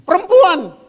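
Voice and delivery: high, loud defensive blurt; Adam blaming. Acted. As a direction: shove the blame away.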